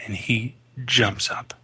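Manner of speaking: normal talking voice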